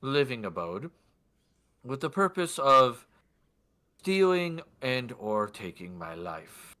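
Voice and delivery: formal, weighty request. Acted. Lord asking guard to investigate, deliberate formal voice. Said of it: "do my best for his voice".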